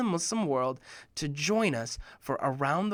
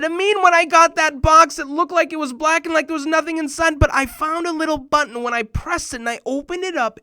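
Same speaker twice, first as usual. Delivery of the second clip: much higher, louder gleeful rush; gleeful telling; 11 semitones higher, 9 dB louder than usual. Retold or imitated. Kamil excitedly explaining the hidden button, gleeful. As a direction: brag about the find. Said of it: "He said".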